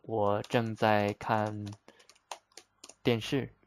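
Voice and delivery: slow, word-by-word model sentence; slow teaching demo. Shown as a demonstration. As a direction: lay it out slowly for students. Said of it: "Another example".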